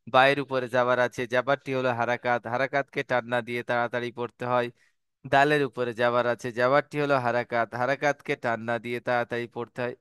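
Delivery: quick recap. Shown as a demonstration. Teacher recapping the rule for each letter, rapid low recitation. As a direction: reel the rules off in a low run.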